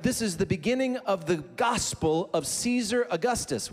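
normal talking voice

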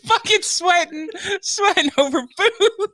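very high, slow, laughing squeal; squealing through laughter. Acted. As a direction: squeak it out, cracking up. Joking about eating into mic.

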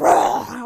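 loud scare shout; mock scream. Dad leaping out from under the bed, mock scream. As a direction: jump out with a mock roar.

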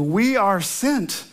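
We are sent said very slowly, each word weighted; emphatic slow theme line. Acted. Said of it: "We're gonna emphasize our mission".

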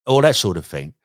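ordinary talk

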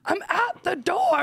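drawn-out, high-pitched mock yell; imitating exasperated husband. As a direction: call slowly through the door, imitating him. Wife voices locked-out husband calling.

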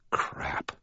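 whispered curse. Real, not acted. Inner realization.

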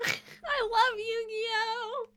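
squealing through laughter; laughing, slow and high. Real, not acted. Laughing gush over the show.

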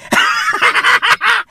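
big shrieking laugh. Real, not acted. Laughing at the absurd text.

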